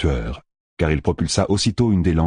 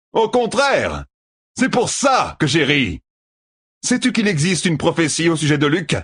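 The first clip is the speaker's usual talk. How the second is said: menacing hunter; 10 semitones higher than usual. Acted. Tueur threatens to kill Perrine and Altor, high and gloating. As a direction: threaten with cruel glee.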